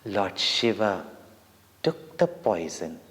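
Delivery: usual speaking voice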